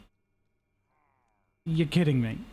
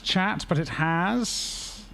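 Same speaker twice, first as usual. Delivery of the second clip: usual speaking voice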